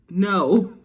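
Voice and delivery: loud, abrupt motherly no; emphatic acted refusal. Mama refusing the basement plan, loud acted no. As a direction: shut it down loudly.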